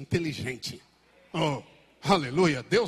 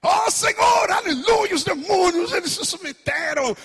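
much higher, louder ecstatic cry; joyful disciples; 12 semitones higher, 6 dB louder than usual. Acted. Disciples return to Jesus, ecstatic. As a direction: burst out in triumph. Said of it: "E os discípulos voltaram".

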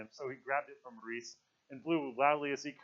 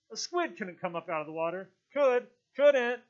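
raised voice building to a high shout; shouted objection; 9 semitones higher, 7 dB louder than usual. A narrator's reading. Someone in the meeting disputes the squid, shouting. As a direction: call out the objection, ending loud and high. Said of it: "Someone shouted".

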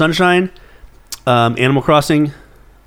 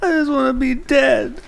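mock despair; 12 semitones higher, 3 dB quieter, slower than usual. Mock despair before hot sauce.

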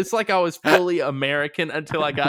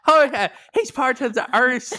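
higher, louder comic Irish lilt; mock Irish accent; 9 semitones higher, 4 dB louder than usual. Co-host plays along with friend, mock Irish accent. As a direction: put on a rough Irish accent. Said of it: "an Irish accent".

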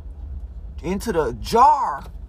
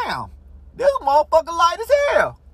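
shocked shout; 11 semitones higher than usual. Retold or imitated. Lifting the jar, shouting in surprise. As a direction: yell it like the jar weighs nothing. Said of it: "I was like".

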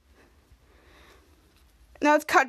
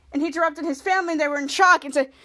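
usual speaking voice

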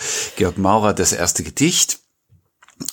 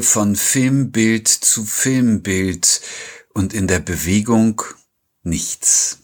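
slow, higher-pitched poetry reading; poem recitation; 5 semitones higher, slower than usual. A narrator's reading. Podcast host opens the episode with a poem, slow recitation. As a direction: recite it slowly with pauses between the lines.

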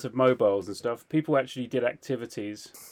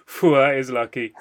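loud, laughing mock relief; retold laughing relief; 8 dB louder than usual. Man laughing off the cracked alarm, mock relief. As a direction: re-enact relieved laughter, loudly. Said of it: "I'm laughing and going".

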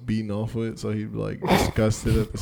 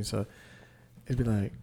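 normal talking voice

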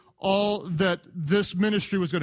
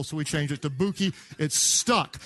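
normal talking voice

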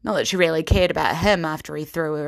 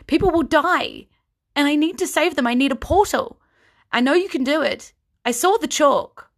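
higher, louder desperate pleading; desperate Kyra; 10 semitones higher, 5 dB louder than usual. Acted. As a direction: push them, people will die. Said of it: "frustrated and desperate".

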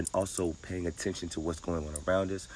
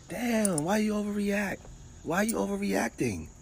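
squeaky, much higher nagging voice; high mocking voice. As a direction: whine it like a scolding bystander. Mocking someone who overreacts.

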